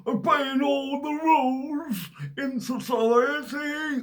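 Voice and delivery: high hammed-up shout, slow at the end; mock rule-follower. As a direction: squawk it like a smug rule-obeying busybody. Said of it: "which are gonna be going".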